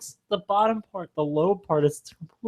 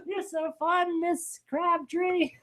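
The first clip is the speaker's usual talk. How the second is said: put-on kid voice; 10 semitones higher, 10 dB quieter, slower than usual. Kids' voice to the driver.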